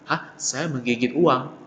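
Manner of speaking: softly puzzled, slowed echo; mildly baffled, quiet repeat of what was heard; lightly acted bewilderment; confused listener. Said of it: "orang dengarnya bingung tuh".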